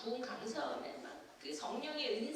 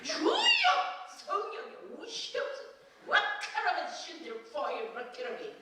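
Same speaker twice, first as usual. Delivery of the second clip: fervent tongues prayer, much higher; pastor praying in tongues; 8 semitones higher, 6 dB louder than usual. Retold or imitated. The pastor speaks in tongues over her head, fervent and high. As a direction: preach it like a pastor laying on hands.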